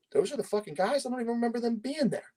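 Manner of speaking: high-pitched bewildered recollection; retold disbelief. Defendant recalling his shock at seeing his accusers, in high-pitched disbelief.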